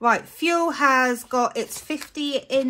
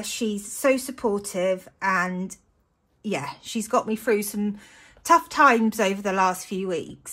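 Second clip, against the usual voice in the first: usual speaking voice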